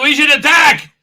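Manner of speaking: high, loud hammed-up shout; rally the room to war, overplayed; mock battle cry. Acted call to attack.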